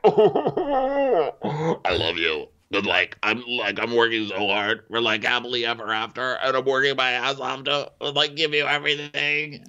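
imitated weepy pleading, stop and start; mock tearful. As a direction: fake sobbing that climbs in pitch. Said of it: "now I'm gonna cry".

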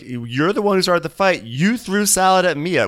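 high, pointed imitation of a quarrel; mock accusation.

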